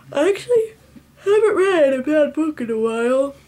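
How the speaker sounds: high, loud, hammed-up tearful whine; mock wail. Book vlogger gushing about her streak of good books, mock wail. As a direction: overcome with joy, playfully fake-crying.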